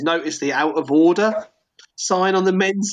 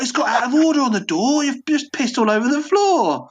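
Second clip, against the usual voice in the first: annoyed workman; 9 semitones higher than usual. Retold or imitated. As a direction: scold him for the mess. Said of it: "And it's like".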